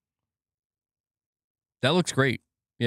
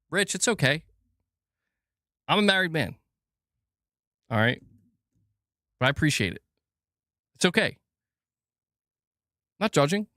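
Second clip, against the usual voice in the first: mock reassuring; 6 semitones higher than usual. Consoling donor who said I love you.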